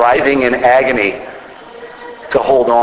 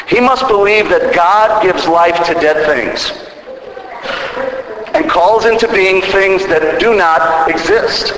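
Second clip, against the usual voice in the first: "must" and "being" lifted higher, building intensity; rising preaching; 5 semitones higher than usual. Acted. Preacher urging the congregation to believe, higher and building. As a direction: climb toward the climax with conviction.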